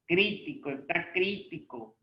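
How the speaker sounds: "crítico" as CRÍ-ti-co; stress on the first syllable. Shown as a demonstration. Teacher asking about the accent, "crítico" stressed on CRÍ. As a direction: punch CRÍ in "crítico", let the rest fall. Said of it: "Crítico sí lleva".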